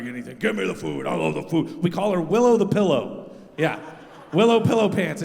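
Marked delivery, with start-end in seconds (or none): 0.0-1.8 low, soft greedy mutt; low greedy dog voice. Retold or imitated. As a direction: grumble it like a hungry dog. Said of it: "She's like".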